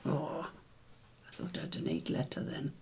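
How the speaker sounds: hushed disappointed murmur; whispered aside. Real, not acted. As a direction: mutter the letdown under the breath.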